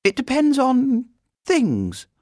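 put-on high, slow girlish evasion; mysterious. A narrator's reading. Girl hinting to the housekeeper, mysterious. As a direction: draw it out mysteriously in a child's voice. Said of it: "looked round mysteriously before replying".

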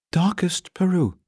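high, careful bear voice; earnest little bear. A narrator's reading. Bear naming his homeland, earnest. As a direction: state it earnestly, pitched high.

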